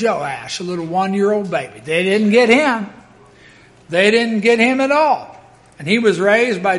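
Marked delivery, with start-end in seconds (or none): none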